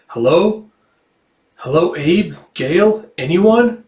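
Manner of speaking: high, questioning calls with rising endings; calling out. Acted. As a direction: call into an empty house for anyone. Calling through an empty house.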